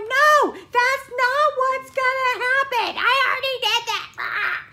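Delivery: high, loud and slow refusal; stubborn whine. Retold or imitated. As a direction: dig in like a stubborn kid. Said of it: "And I'm like".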